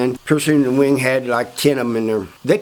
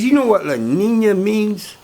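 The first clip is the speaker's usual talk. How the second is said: high, slow quizzing; pointed question; 7 semitones higher than usual. Retold or imitated. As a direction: ask slowly and high, as if he's clueless.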